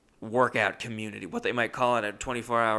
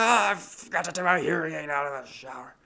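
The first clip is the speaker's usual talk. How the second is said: higher, strained imitation of panicked roommate; panicked yell; 6 semitones higher, 4 dB louder than usual. Deaf roommate explains the screeching hearing aid, panicked yell. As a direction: cry out the explanation in mock panic.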